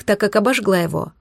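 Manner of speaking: usual speaking voice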